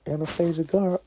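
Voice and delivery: rising high call of her name; teasing. Pretend. Calling out to Anastasia, teasing and high. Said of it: "I was like".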